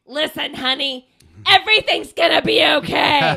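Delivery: tough, loud Brooklyn reassurance; martini-drinking smoker's Brooklyn accent, punched out. Acted. Reassuring a client who needs to hear it, tough Brooklyn accent. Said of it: "smoking Brooklyn accent to go".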